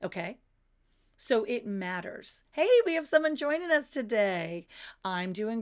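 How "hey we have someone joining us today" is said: higher, louder hello to a newcomer; light up for the new arrival; excited greeting. Real, not acted. Host welcoming a viewer joining the live stream, excited.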